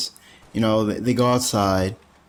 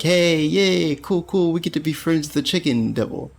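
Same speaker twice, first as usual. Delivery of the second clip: mock joyful teacher; 6 semitones higher than usual. Teacher's joyful quote voiced.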